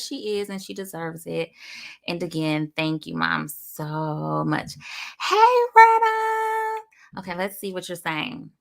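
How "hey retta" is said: high, loud, long drawn-out hello; excited greeting. Real, not acted. Host calling out to a viewer joining the live, excited. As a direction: sing out the name, stretched and high.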